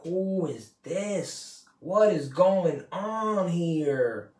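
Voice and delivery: slow, drawn-out bewildered question; a touch of baffled disbelief, stretched out; confused reaction. Retold or imitated. Reacting to miserable friends' negative talk, slow mock confusion. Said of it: "you're just like".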